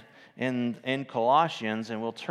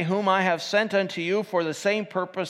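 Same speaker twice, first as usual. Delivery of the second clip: lifted King James reading voice; scripture reading; 7 semitones higher, 3 dB louder than usual. Acted. Preacher reads Colossians four to the church, lifted reading. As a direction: read the verse out, measured and raised.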